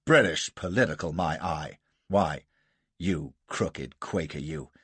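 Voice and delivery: a touch of American indignation; mild outburst. A narrator's reading. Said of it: "Gardner exploded".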